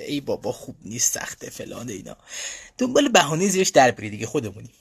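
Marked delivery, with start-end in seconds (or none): 0.1-2.2 mock reluctant student, trailing off softly; grumbling excuse. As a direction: mutter a half-hearted excuse, fading away. Acted excuse to avoid studying.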